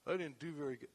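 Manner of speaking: low, soft, mopey muttering; a deflated sulk, lightly played; mock dejected. Philip sulking after a failed invite, softly. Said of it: "walk away and go".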